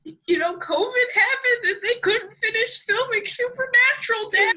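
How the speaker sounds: very high, hammed-up wail; mock screaming.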